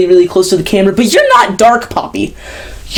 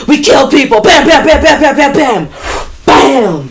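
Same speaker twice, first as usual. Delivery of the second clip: mock gun noises; 6 semitones higher than usual. Reviewer imitating the violent chorus, mock gunfire bams. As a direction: punch out each bam like gunfire.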